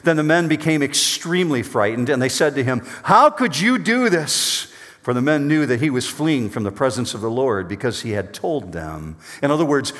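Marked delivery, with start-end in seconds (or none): 3.0-4.7 higher, slow and accusing; accusing sailors. Acted. Sailors confronting Jonah about the storm, slow shocked accusation. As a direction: draw it out in shocked blame.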